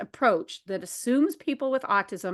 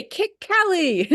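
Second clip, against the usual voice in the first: put-on child's demand; 9 semitones higher, 5 dB louder, slower than usual. Quoting Dylan's demand.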